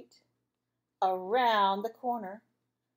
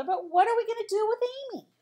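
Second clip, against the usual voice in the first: high, fast anxious mom; fretting mother; 12 semitones higher, 5 dB louder, faster than usual. Acted. Mother flustered about Amy, high and fast. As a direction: rush it out in a worried fluster. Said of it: "said her mother".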